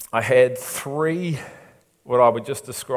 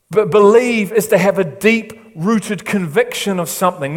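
raised, emphatic sermon delivery; preaching; 6 semitones higher, 7 dB louder than usual. Acted. Pastor preaching to his church, raised and emphatic.

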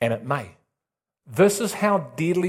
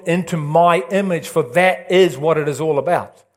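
full-force preaching; 2 semitones higher, 4 dB louder than usual. Acted.

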